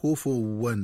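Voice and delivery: "كفوا" misread as "kufuwwan"; "كفوا" said ku-fuw-wan; wrong doubled waw. Shown as a demonstration. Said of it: "قراءتها بتشديد الواو هكذا".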